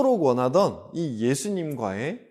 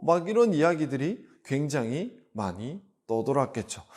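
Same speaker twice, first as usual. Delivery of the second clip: ordinary talk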